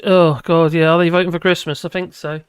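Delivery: ordinary talk, nothing marked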